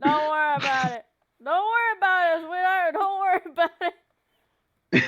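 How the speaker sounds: loud, teasing sweet imitation; mock him, all sugary. Mocking Gurr's line, louder.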